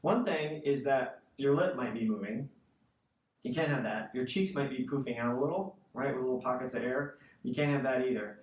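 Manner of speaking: normal talking voice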